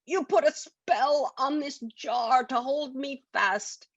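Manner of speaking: high, slow goblin voice; slow high goblin accusation; accusing goblin. Acted. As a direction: spell it out as the angry goblin. Goblin voice in story.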